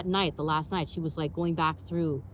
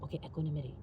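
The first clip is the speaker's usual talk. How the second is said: calming self-talk; 3 semitones lower, 9 dB quieter, faster than usual. Retold or imitated. Calming herself down.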